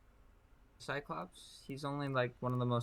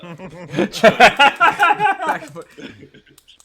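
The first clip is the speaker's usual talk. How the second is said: loud laugh; 14 semitones higher, 20 dB louder than usual. Real, not acted.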